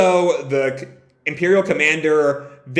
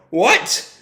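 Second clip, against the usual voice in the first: higher, rising startled question; acted burst of disbelief; shocked; 6 semitones higher than usual. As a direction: react to alarming news. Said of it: "Shocking everyone".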